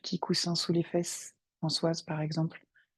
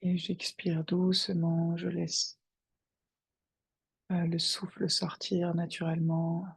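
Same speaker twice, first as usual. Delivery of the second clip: guided meditation. Acted. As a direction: guide them gently into their breath. Guide une méditation. (voix de méditation guidée, calme et posée)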